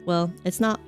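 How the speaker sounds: usual speaking voice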